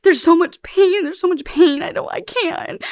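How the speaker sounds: retold crying. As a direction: replay her broken plea from the tape, slowly. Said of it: "Like, I'm crying".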